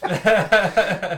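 big burst of laughter; loud laugh. Real, not acted. Both laughing at ad.

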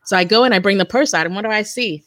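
normal talking voice

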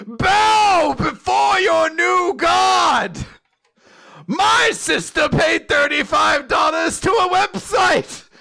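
high, slow, booming proclamation; booming arrogant alien overlord. Pretend. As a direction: bellow it like a self-crowned tyrant. Proclaims himself lord of Scotland.

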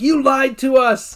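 high, drawn-out accusation; mock outraged crowd; exploding. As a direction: wail it slowly in protest. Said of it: "And they explode".

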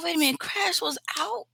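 high-pitched incredulous question; surprised. Retold or imitated. Learning Crash was out back then, surprised. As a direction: pitch it up in disbelief.

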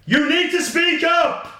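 high, drawn-out loud shout; mock shout. Shouted feedback to co-host.

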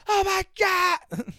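3 wail it like a scandalized parent; 4 scandalized parent shrieking in horror; 1 shocked gasp. Retold or imitated. Real parents' shocked reaction.

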